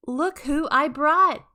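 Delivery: excited reveal to wife; proud announcing. A narrator's reading. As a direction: show off the surprise.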